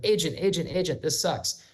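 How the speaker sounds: mock-impatient plea to a phone system; exasperated caller. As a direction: lightly act out a fed-up caller repeating themselves. Acting a caller stuck in phone menu.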